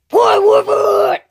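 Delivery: loud, high, slow strained shout; drawn-out garbled yell; straining outburst. Acted. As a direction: burst out while fighting to hold back. Ash struggles not to yell.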